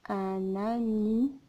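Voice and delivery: "Un anniversaire" softly split as un-anni, ver, saire; syllable by syllable. Shown as a demonstration. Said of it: "j'entends pas en". (« Un anniversaire » dicté doucement, syllabe par syllabe)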